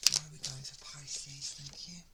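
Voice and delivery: hushed whisper, breath only; soft secret whisper to the universe. Real, not acted. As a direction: whisper it as if to the cards. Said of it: "repeats question to the universe".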